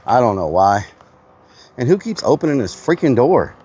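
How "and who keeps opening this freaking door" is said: scolding. Real, not acted. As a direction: scold the door-openers. Scolding whoever opens door.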